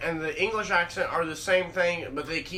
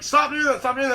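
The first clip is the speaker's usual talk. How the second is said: high, louder mock protest; imitated urgent protest; 8 semitones higher, 5 dB louder than usual. Imitating buddy telling him to stop, high urgent protest. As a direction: plead urgently, pitched up, as the buddy. Retold buddy objecting to the swearing.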